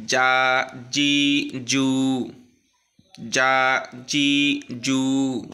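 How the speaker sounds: "জা, জি, জু" loud, each vowel held one beat; one-beat stretch. Shown as a demonstration. Teacher reading together before learners repeat, lengthened "জা, জি, জু". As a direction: chant "জা, জি, জু" with long even vowels. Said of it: "এক টান হবে শুধু".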